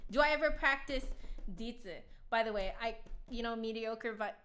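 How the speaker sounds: usual speaking voice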